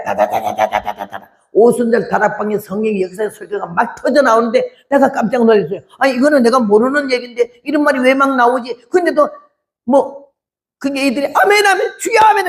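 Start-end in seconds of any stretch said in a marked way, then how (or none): 0.0-1.3 low rapid-fire rattle of syllables; imitated rat-a-tat in a low voice; machine-gun imitation. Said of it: "따발총도 저리 가래". 11.3-12.5 high-pitched mock amens; high, excited crowd imitation; noisy congregation. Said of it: "하게 시끄럽다고".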